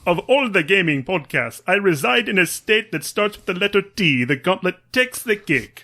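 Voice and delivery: attempted Transylvanian vampire accent, sounding Jamaican; botched, lilting Transylvanian accent; the Count. Reading a listener's review as the Count in a botched Transylvanian accent. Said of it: "That is the Count in my head".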